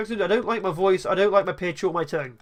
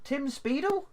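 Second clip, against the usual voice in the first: surprised; 5 semitones higher, 7 dB quieter, slower than usual. Real, not acted. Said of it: "completely taking me by surprise".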